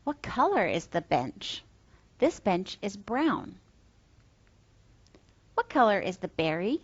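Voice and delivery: gentle, singsong talk for toddlers; reading to a toddler. Acted. Teacher voice for toddler lessons.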